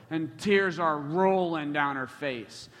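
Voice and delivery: ordinary talk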